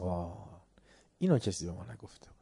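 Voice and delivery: quick hushed whisper, voicing the listeners; whispered aside. Lecturer voices the listeners' surprise to the class, whispering. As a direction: breathe it out fast as a secret.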